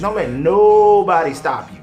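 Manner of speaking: ordinary delivery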